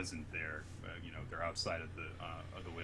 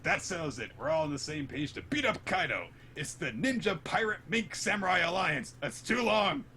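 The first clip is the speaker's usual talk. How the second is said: high, loud announcement building higher; hammed-up heroic proclamation; mock rallying cry; 11 semitones higher, 5 dB louder than usual. Crew member names the new alliance, mock rallying cry.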